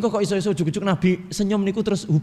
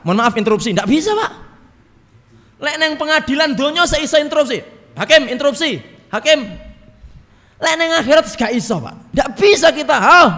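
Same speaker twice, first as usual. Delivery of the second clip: higher, louder indignant objection; mock protest; 8 semitones higher, 4 dB louder than usual. Preacher playing a sinner protesting in heaven's court, indignant.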